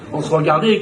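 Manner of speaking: usual speaking voice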